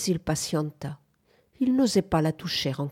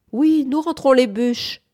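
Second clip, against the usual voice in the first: raised, louder answer across a distance; calling back; 7 semitones higher, 6 dB louder than usual. A narrator's reading. Henry answering Bunford's call from the house, calling back. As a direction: call back so they hear it indoors.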